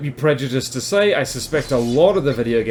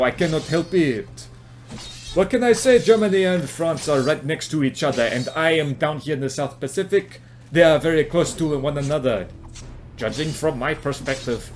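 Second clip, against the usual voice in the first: hammed-up German-French accent; mock Franco-German accent; 2 semitones higher than usual. Shown as a demonstration. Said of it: "I am veering into French right now".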